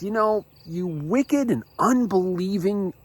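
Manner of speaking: high-pitched, exasperated scolding; stern rebuke. Retold or imitated. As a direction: rebuke them at the end of your patience. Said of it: "that's a stern rebuke".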